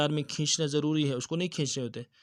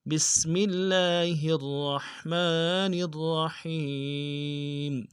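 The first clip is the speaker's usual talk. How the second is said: slow melodic tajweed recitation; Quran recitation; much slower than usual. Shown as a demonstration. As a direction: chant it slowly with full tajweed. (قرآن کی تلاوت، آہستہ اور سریلی)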